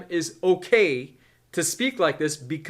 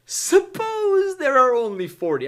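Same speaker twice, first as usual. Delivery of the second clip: Abraham; 8 semitones higher, 3 dB louder than usual. Acted. Abraham asking God to spare the city for forty, high-pitched appeal. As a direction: stretch out a pleading "suppose". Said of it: "I can only imagine how he's asking this question".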